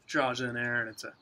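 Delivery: talking around pipe stem; pipe in mouth. Real, not acted. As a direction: lips gripping pipe. Seen 0: pipe stem held between lips from 3.1s while talking.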